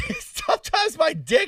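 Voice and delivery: very high, slow laughing mockery; mocking echo. Cohost mocking the tough-times excuse, high and laughing. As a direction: mimic him sarcastically through a laugh.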